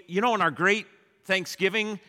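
ordinary talk

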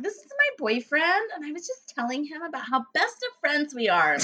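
high, sugary mock of a fake-friendly girl; imitating a fake-sweet girl introducing her boyfriend; mock girly voice. Mocking the girl's fake friendliness.

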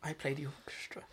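hushed unvoiced whisper. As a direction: whisper it like a secret. Whispered quote.

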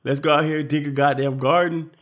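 high, loud rallying cry to a crowd in chaos; rallying leader. Pretend. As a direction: rouse the frightened survivors like a self-made leader. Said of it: "lead these motherfuckers to freedom".

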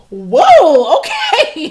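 flustered, delighted gasp of a whoa; surprised. Retold or imitated. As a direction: caught off guard by a handsome stranger. Handsome man at her door.

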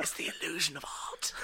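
laughing mock whisper; stage whisper. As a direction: keep whispering through a giggle. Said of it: "It's, it's a stage whisper".

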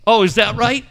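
loud, high, sharp comeback; heated. Retold or imitated. Co-host firing back at a rude caller, loud and heated. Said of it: "Katie starts getting heated".

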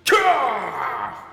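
put-on frail old man's battle cry; high strained old man shout. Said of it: "I don't sound like a frail old man".

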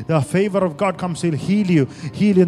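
loud, fervent healing prayer; preaching at full force. Acted. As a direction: preach it out with fire.

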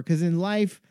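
normal talking voice

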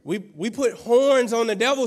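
ordinary delivery

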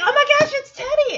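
high, loud, quick squeal; mock excited cry. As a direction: act out shrieking at an old friend. Voices excited friend greeting Teddy.

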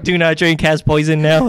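loud, high warning beep-voice; robot voice. Pretend. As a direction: announce it like an alarm. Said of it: "they're like".

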